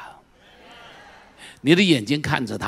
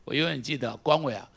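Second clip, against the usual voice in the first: ordinary talk, nothing marked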